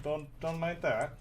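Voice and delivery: ordinary delivery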